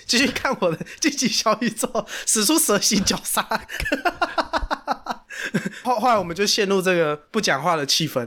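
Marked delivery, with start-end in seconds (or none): 3.5-5.8 high, softer burst of laughter. Real, not acted. Teller laughing at his own petty anger. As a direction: laugh at yourself.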